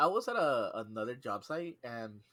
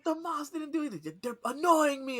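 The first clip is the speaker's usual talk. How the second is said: higher, petulant complaint; whiny coworker; 14 semitones higher, 4 dB louder than usual. Retold or imitated. Coworker complaining the moths annoy him, whiny. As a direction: whine like an annoyed kid. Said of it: "They're like".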